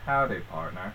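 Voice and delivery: a lightly done John Wayne cowboy; cowboy accent. Pretend. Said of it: "That's all you're gonna say".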